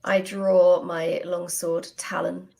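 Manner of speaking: ordinary delivery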